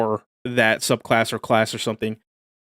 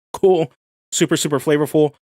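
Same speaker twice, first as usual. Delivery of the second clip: excited; 4 semitones higher, 4 dB louder than usual. Real, not acted. Reacting to new bard rules.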